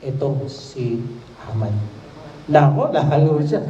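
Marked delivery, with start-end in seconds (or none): 0.0-1.8 quiet. A narrator's reading. Esther names the enemy.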